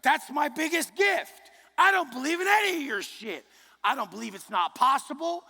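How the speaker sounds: high shouted declaration of belief; shouting. Real, not acted. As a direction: bellow it with conviction. Preaching climax.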